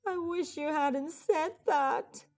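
put-on a lightly groaned complaint from a child; groaning. A narrator's reading. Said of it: "Camilla groaned".

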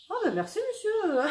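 high, giggly mock-flattered thank-you; laughing thanks. To the vendor who called her beautiful, high laughing thanks. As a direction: coo it back, flattered and amused.

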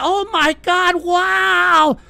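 high, slow mock gasp of wonder; mock amazed family. Family members gushing over tech gifts, high mock amazement. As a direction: imitate relatives thrilled by a gadget. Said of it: "they're like".

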